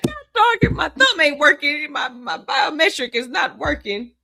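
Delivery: loud, hammed-up frustrated mom; imitating a flustered, forgetful mother; mock exasperation. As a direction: complain loudly at the stubborn phone. Imitates mother failing to unlock phone.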